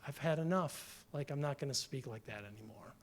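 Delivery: a hint of a weary Job; quiet resignation. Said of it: "I think what Job does here is he, he says".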